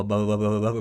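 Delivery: ordinary delivery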